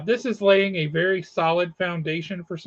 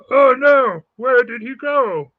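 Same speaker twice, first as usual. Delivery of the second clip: loud, high hammed-up dismay; mock alarm; 7 semitones higher, 10 dB louder, slower than usual. Host whose co-host has vanished, mock-alarmed cry. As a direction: cry out in playful panic, drawn out at the end.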